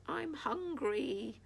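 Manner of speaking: high, drawn-out wail. A narrator's reading. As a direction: whine it out long and high like a hungry dog. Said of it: "wailed Knave".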